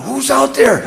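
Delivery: high, loud startled call; yelling out. Retold or imitated. Scared Derek calling to whoever is in the kitchen, yelling out. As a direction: shout it into the dark house. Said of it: "Derek yells out".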